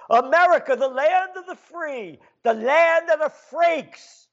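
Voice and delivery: high, grand sarcastic proclamation; mocking proclamation. Real, not acted. Host mocks the land of the free as freaks, high and sarcastic. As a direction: announce it like a hollow slogan.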